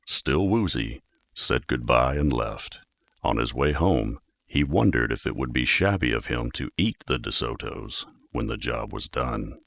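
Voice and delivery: ordinary delivery, nothing marked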